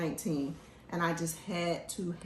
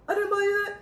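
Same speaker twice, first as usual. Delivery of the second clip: high, louder mock dad denial; imitating dad; 13 semitones higher, 5 dB louder, faster than usual. Son mimicking his dad denying he bought anything. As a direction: put on your dad's voice, denying it. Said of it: "he says".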